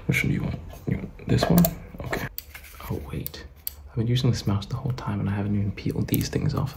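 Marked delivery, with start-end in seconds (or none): none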